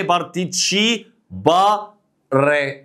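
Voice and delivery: "بارتيتشي باري" said slowly, syllable by syllable; careful Italian pronunciation; slow Italian model. Shown as a demonstration. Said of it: "teacher models Italian phrase".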